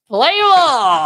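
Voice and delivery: high, loud ballpark call; mock rousing yell. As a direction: holler it like an anthem's finish. Wife's yell ending the anthem.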